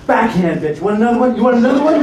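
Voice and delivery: loud, high-pitched taunting challenge; gangster monkey. Retold or imitated. Monkey threatening the comedian after slapping him, gangster taunt. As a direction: taunt him like a cocky street thug. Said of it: "This is how gangster this monkey was".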